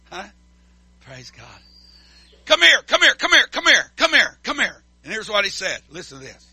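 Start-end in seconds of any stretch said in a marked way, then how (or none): 2.4-4.8 higher, louder repeated summons; urgent calling. Retold or imitated. Jesus calling the steaming disciples over, loudly. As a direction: call the angry disciples in. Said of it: "Jesus called them unto him".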